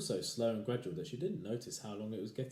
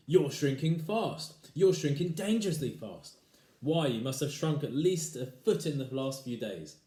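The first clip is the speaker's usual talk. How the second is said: taunting husband; 5 semitones higher, 8 dB louder than usual. Acted.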